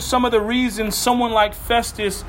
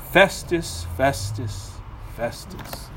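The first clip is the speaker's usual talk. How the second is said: fading sigh of a name; 7 semitones lower, 5 dB quieter, slower than usual. Acted.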